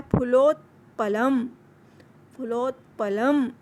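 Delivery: फुलोत्पलम said clearly, syllable by syllable; careful teacher model of फुलोत्पलम; pronunciation demo. Shown as a demonstration. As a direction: break फुलोत्पलम into its parts for students. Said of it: "मेरे साथ साथ आप भी इन शब्दों का उच्चारण कीजिए".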